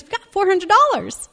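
high, loud, excited announcement; totally excited. Retold or imitated. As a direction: imitate a friend thrilled with the total. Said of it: "she's com-, totally excited".

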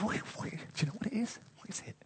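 whispered, hushed wondering; puzzled whisper. Acted. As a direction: whisper it like a baffled crowd. Israelites puzzling over manna.

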